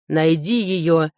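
slow, restrained bark; barked order. A narrator's reading. Lord orders the steward to find his daughter, restrained bark. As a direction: give a curt order with weight, drawn out. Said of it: "рявкнул лорд и посмотрел на управляющего".

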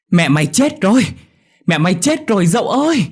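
high, loud urgent yell; shouting while running. A narrator's reading. Mẹo running to Dậu with terrible news, shouting. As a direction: call out the alarm from afar. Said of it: "vừa chạy vừa kêu lớn".

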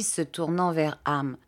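ordinary delivery

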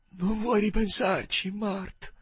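high, slow, drawn-out plea; high-pitched forlorn appeal; pleading without hope. A narrator's reading. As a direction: ask as if you expect a no. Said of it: "gli chiese senza molta speranza".

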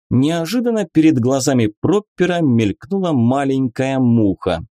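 usual speaking voice

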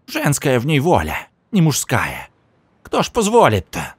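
high, croaking crone voice; croaking old woman. A narrator's reading. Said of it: "каркнула третья".

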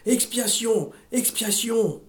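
ordinary delivery

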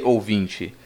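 usual speaking voice

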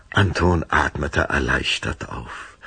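normal talking voice

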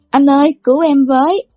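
a hammed-up wail down the phone; wailing plea. A narrator's reading. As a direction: younger brother wailing to big brother for rescue. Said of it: "gào khóc tê tâm liệt phế".

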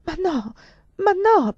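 very high, dismayed protest; high-pitched horrified denial; appalled stammer. A narrator's reading. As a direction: protest in shocked alarm. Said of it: "balbettai esterrefatta".

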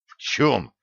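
ordinary delivery

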